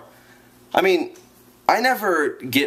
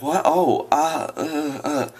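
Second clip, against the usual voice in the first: higher, mock flustered stammer; caught off guard; 3 semitones higher, slower than usual. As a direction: play her startled and lost for words. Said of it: "She got all wide-eyed".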